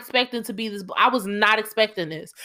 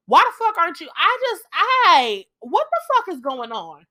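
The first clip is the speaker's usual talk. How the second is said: frustrated disbelief; 6 semitones higher than usual. Real, not acted. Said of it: "'Cause now I'm mad again".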